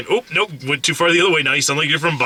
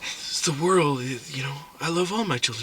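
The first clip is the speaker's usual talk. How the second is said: hushed voice; 8 dB quieter than usual. Retold or imitated. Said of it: "people whispering".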